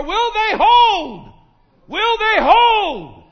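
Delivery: high, loud, slow dramatic cry; tense cry. Acted. Preacher crying out to the congregation at the Red Sea. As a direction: cry out the fear that the walls won't hold.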